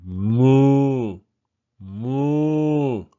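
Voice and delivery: long, loud moo; cow moo. Acted. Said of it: "said the cow".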